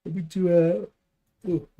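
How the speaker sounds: words stretched out by a yawn. Real, not acted. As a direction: say it through a big yawn. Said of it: "sorry, a yawn".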